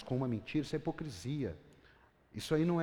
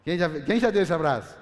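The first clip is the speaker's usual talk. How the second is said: ordinary delivery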